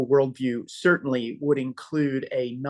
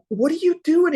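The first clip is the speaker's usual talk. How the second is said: pitched high and loud, caught off guard; sudden alarmed what are you doing; startled question; 13 semitones higher, 5 dB louder than usual. Retold or imitated. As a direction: react as if walking in on something alarming. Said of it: "it's like".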